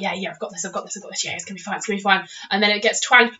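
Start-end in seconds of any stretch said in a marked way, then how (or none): 0.0-2.3 muttered under the breath, softer, talking yourself into it; soft nervous mantra before being flung; nervous self-reassurance. Retold or imitated. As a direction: quietly psych yourself up before the launch. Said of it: "and you're like".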